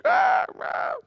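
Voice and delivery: put-on high whimpering child cry; imitated child sob. Three-year-old crying in the back seat, high sobbing. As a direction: mimic a toddler's breathless sobs.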